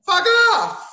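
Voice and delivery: very high, loud yell; real exasperated outburst; angry shout. Real, not acted. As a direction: scream it in disgust.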